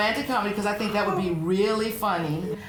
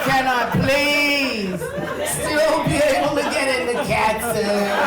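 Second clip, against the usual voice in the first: laughing; 5 semitones higher, 5 dB louder than usual. Real, not acted. Joking about cat suit.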